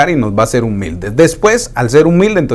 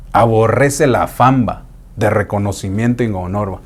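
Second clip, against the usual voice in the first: usual speaking voice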